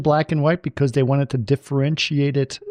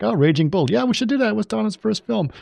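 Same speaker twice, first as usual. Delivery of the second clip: high-pitched eager realization; retold excitement; 10 semitones higher than usual. Colleague recalling the group picking a film, excitedly. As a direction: act out jumping at an idea, voice up high.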